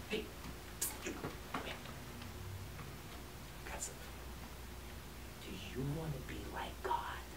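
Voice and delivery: whispered tempting serpent, very soft; sly snake whisper. Acted. Snake whispers temptation to Adam and Eve, hushed. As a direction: lure them in with a hushed hiss-free whisper. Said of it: "Snake says".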